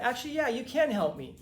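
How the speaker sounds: a little aggressive. Retold or imitated. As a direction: square up to a stranger. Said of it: "a little aggressive".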